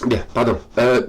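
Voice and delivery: sniffly, slightly stuffed nose; sniffling. Real, not acted. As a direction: speak through a sniffle. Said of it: "excusez-moi, je renifle".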